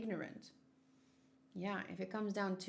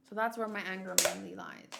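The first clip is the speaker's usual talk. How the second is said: ordinary delivery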